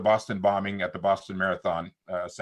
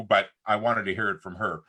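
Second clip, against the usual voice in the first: ordinary delivery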